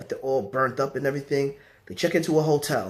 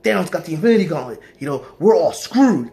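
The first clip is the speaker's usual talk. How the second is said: mock panic; 3 semitones higher, 4 dB louder, faster than usual. Silver Surfer warning Doctor Strange, acted panic. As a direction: blurt the warning, growing more frantic. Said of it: "he's panicking to Doctor Strange".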